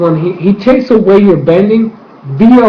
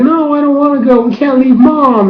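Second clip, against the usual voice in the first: put-on high, pleading little boy; a son begging his brother to stay, high and upset; upset child; 9 semitones higher than usual. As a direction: whine it like a kid who won't leave his mom. Young boy refusing to abandon mother.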